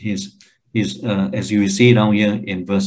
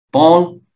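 "Paul" called higher and louder; emphatic naming; 5 semitones higher, 5 dB louder than usual. Shown as a demonstration. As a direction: hit "Paul" hard and high. Demonstrates emphatic repetition of name.

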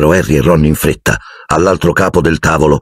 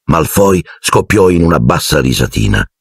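ordinary delivery

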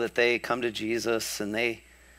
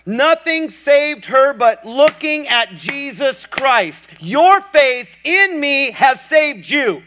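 rising louder and higher, slowing to the climax; preaching building to full force; 12 semitones higher, 7 dB louder than usual. Acted. Preacher driving home what saved her, building full force. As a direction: build the sermon to a shouted peak.

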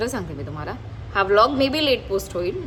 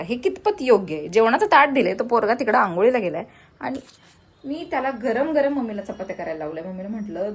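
ordinary talk

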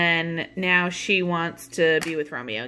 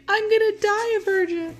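much higher, hammed-up Juliet voice; mock Juliet. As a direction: squeak it out like a melodramatic teen Juliet. Teacher voices Juliet's despair.

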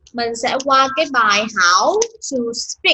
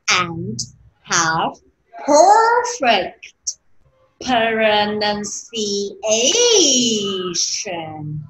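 slow model reading; much slower than usual. Shown as a demonstration. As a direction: drag each word out for the class. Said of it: "reading the sentence again". (đọc mẫu rất chậm, "perfect" vút cao)

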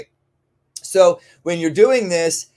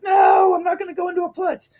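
high, fast mock puppy protest; stubborn puppy. Trainer voicing a puppy refusing the down command. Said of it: "they're like".

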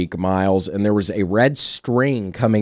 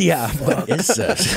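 laughing; 11 semitones higher, slower than usual. Real, not acted. Laughing at yarn story.

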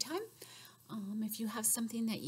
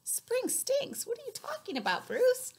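very high, fast astonished bunny; 14 semitones higher than usual. Acted. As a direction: squeak it like a shocked little bunny. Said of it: "says Ruth".

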